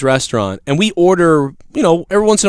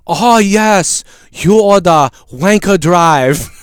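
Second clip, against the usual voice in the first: slow imitation of a Japanese accent; 4 semitones higher, 3 dB louder, much slower than usual. Restaurant guy greeting customer, mock Japanese accent. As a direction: stretch out Wanker Drive in a Japanese accent. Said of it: "the fucking guy goes".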